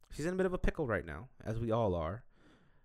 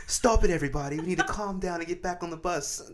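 higher-pitched mock British scolding; drunk British mom; 7 semitones higher than usual. Host imagining her herding friends onto the bus, mock British mom. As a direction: boss the group like a British mother. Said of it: "you showed up as the drunk British mom".

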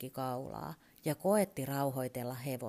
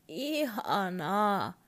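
horse voice; 4 semitones higher, 4 dB louder, much slower than usual. Acted. Horse speaks its only word.

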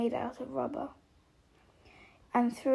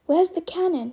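put-on a dozy, dim-witted boy asking, a little louder; stupid question; 5 semitones higher, 8 dB louder than usual. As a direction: blurt it out, half asleep. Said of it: "he said stupidly".

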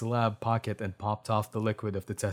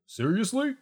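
high, rising question of disbelief; astonished; 12 semitones higher than usual. A narrator's reading. Doctor hearing the samples are perfect, astonished. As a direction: shoot the pitch up in amazement. Doctor's eyes widen, redone line.

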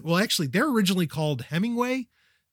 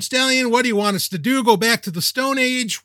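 higher, mildly mocking whine of a listener; mock exasperated listener; 6 semitones higher, 3 dB louder than usual. Listener complaining to the host about phones, mildly mocking tone. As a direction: lightly imitate an annoyed listener pushing back. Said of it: "You're probably screaming at the smartphone".

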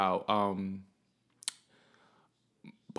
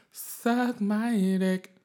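high, slow, drawn-out taunt; mocking dismissal; 10 semitones higher, 3 dB louder, much slower than usual. Taunting the listeners who don't like the stories, drawn out high and slow. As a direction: stretch it out to dismiss the haters. Defiant sign-off to critics.

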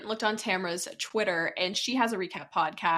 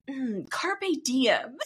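mock wise drawl; 2 semitones higher, much slower than usual. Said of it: "he's like".

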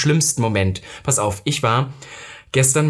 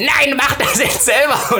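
high, drawn-out exasperated outburst; exasperated refusal; 11 semitones higher, slower than usual. Retold or imitated. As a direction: act out losing patience, stretching the words. Second refusal, now exasperated. (genervt, hoch und langgezogen)

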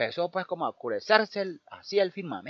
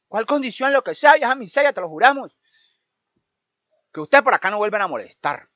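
put-on high, loud stern old woman laying down terms; loud bargaining; 4 semitones higher, 11 dB louder than usual. Old woman setting her condition to the boys, loud and stern. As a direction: shout the condition up the tree.

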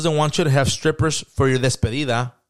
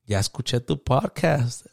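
slow, pleased mom announcing news; happy mom imitation; 4 dB quieter, slower than usual. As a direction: say it like a mom proud of herself. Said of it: "She's like ... all happy".

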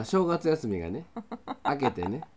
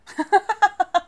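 burst of laughter; 12 dB louder than usual. Real, not acted. Bursts out at own mistake.

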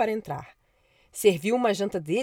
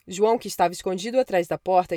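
ordinary delivery

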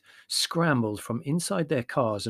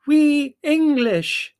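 very slow, high, loud yell; 11 dB louder, much slower than usual. A narrator's reading. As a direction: yell word by word at foreigners. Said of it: "she yelled at the gesticulating drivers".

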